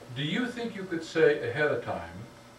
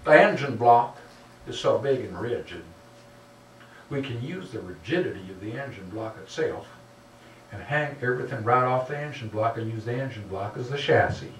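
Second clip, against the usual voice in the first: mock Southern engineer, really more North Carolina than Texas; pseudo Texas accent. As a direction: put on a bad Texas accent. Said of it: "this is my pseudo Texas accent, it turns out it's actually a North Carolina accent".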